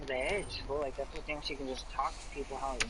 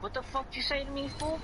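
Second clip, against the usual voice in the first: pitched-up, heated retort; angry challenge; 12 semitones higher than usual. Retold or imitated. Getting up to confront Broderick, heated high challenge. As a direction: snap back high and hot.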